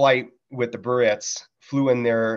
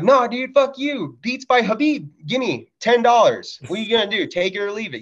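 mock heated haggling; 11 semitones higher than usual. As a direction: push back hard on the price. Retold argument with clerk.

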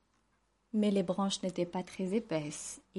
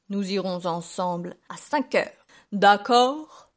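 wolf voice, louder on the final question; persuasive wolf; 6 dB louder, slower than usual. A narrator's reading. Wolf arranging a meeting with the pig, persuasive. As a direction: propose it, then push for agreement.

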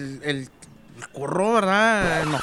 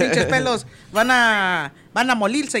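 angry mock Chinese accent; 3 semitones higher, slower than usual. Chinese man curses at the attacking dogs in a mock Chinese accent. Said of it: "sabía maldiciones".

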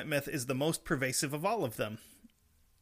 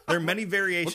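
loud, higher, fed-up restart; exasperated; 4 semitones higher, 7 dB louder, faster than usual. Real, not acted. Host resuming after co-host's heckling, exasperated. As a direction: push it out over the interruptions, exasperated. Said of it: "I huffed out of exasperation".

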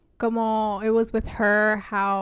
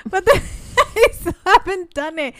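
laughing; 8 semitones higher, 6 dB louder than usual. Real, not acted. Laughing at own delay.

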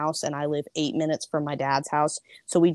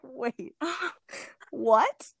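surprised disbelief; 7 semitones higher than usual. Retold or imitated. Shocked by dad's invitation.

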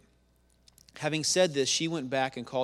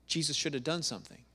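ordinary talk

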